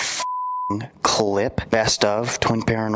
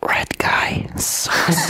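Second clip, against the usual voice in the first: whisper; 5 semitones higher, much slower than usual. Acted. Whispered Among Us line.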